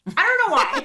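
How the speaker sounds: a rueful laugh breaking in. Real, not acted. Mother laughing at her yearly May arrests.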